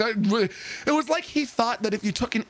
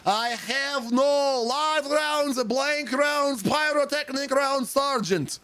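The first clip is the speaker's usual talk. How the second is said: higher, slow, drawn-out recruit voice; slow recruit recital; 7 semitones higher, much slower than usual. Retold or imitated. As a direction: drag out the declaration like a dim recruit. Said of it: "and he goes".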